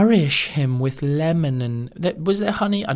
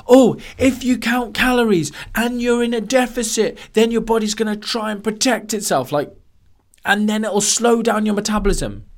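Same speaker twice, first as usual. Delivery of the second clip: mock quote; 8 semitones higher than usual. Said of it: "people go".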